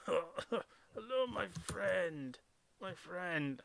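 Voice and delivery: lightly gruff greeting voice; a hint of a gruff character; gruffled voice. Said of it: "I was expecting to hear a sort of a gruffled kind of".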